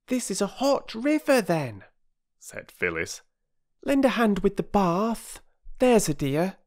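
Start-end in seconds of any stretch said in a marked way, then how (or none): 0.1-1.8 put-on very high girlish comeback; girl's retort. Child Phyllis retorting to her brother. 3.8-6.6 put-on high, sweetly coaxing child; coaxing girl. Child Phyllis coaxing her brother.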